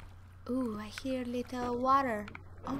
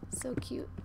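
whisper; 6 dB quieter than usual. Real, not acted. Cooing over her dogs.

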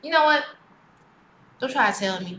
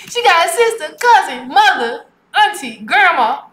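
voicing the guys; 8 semitones higher than usual. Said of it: "They be like".

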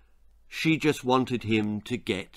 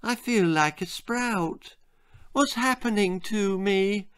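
put-on bewildered boy; 8 semitones higher than usual. A narrator's reading. Bill answering his mother about the sprouts, bewildered. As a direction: voice a small puzzled boy, pitched up. Bewildered boy's voice in narrated story.

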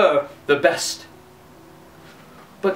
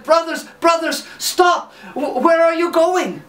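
high, eager call after his brothers; Hans calling; 10 semitones higher than usual. Acted. Hans calls high and eager after his riding brothers.

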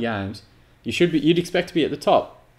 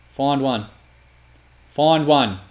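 raised, insistent shout at the player; will the pass to happen, building louder; urgent calling out; 6 dB louder, much slower than usual. Real, not acted.

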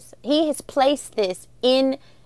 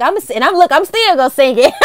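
playful defiance; 4 semitones higher, 7 dB louder, faster than usual. Retold or imitated. Playful defiance in retold quarrel.

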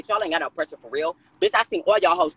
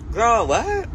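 surprised; 8 semitones higher than usual. Real, not acted. Reacting to gossip on stream.

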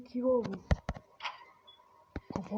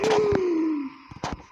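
loud high gasp; startled gasp; 7 semitones higher, 5 dB louder than usual. Real, not acted. Something moves at the blackboard, startled gasp. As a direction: gasp at the sudden fright. Scared by the board.

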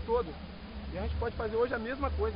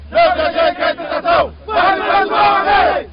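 the Lord's Prayer and Hail Mary yelled loud and together; shouted team prayer; 3 semitones higher, 12 dB louder than usual. Real, not acted. Players in the huddle shouting the prayer before kickoff, at full volume. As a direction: roar the prayer like a war cry.

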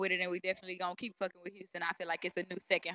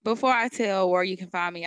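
loud; 10 dB louder than usual. Real, not acted. Hyped guest grabs the mic.

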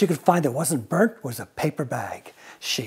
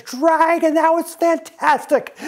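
much higher, thrilled squeal; gushing praise; 13 semitones higher, 4 dB louder than usual. Acted. Elizabeth cheering the dragon's fire, very high and gushing. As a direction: gush over the fire like a fan.

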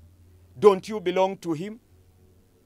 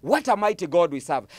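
usual speaking voice

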